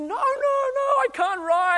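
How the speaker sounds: very high, loud tantrum whine; upset child. Retold or imitated. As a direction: wail like a kid in a tantrum. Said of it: "he was really upset".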